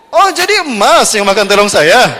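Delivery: slow, loud astonished question; a mock-shocked wife. Wife learns the husband ate her eggplant, slow and astonished.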